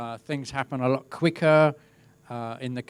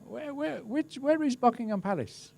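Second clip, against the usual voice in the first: confused tourist; 12 semitones higher than usual. Retold or imitated. Tourist asking the way to the palace, high and puzzled. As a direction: play a lost tourist asking the way. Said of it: "as most tourists would say".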